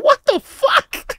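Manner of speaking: high, drawn-out mock outrage; shocked disbelief. Reacting to friend's fridge theft, shocked.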